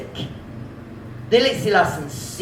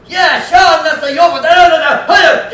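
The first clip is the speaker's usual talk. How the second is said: high, loud angry yelling; yelling driver; 10 semitones higher, 8 dB louder than usual. Retold or imitated. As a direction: shout blame at the other driver. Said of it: "just kept on yelling".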